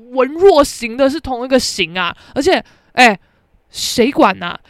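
loud, incredulous outburst; loud sassy tirade; outraged rant. Retold or imitated. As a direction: blast her friend, loud and sassy. She explodes at classmate.

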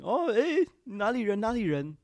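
high, loud eager question; excited teacher. Retold or imitated. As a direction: burst out curious and delighted. Teacher excitedly asks origin.

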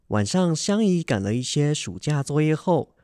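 ordinary talk

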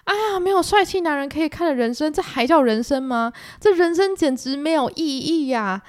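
high, slowing lament over a dull life; mock wailing. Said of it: "广大少女们就每天哀嚎".

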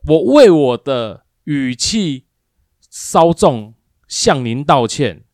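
slow, deliberate, overly polite; stiff formal apology. Retold or imitated. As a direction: lay out each word like an official statement. (慢慢地、一字一字地正式道歉)

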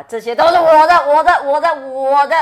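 loud greedy mine mine; possessive whining. Retold or imitated. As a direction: shout it like a spoilt pet. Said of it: "跟那个Trevor说啊".

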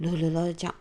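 ordinary delivery

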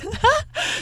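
a quick squeaky giggle; high giggle. Real, not acted. Cohost giggling at her own childhood mischief, high. Laughs after story.

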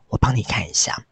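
hushed unvoiced whisper. Psychic whispers to Hank while reading his future. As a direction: whisper it like a mystic reading fate.

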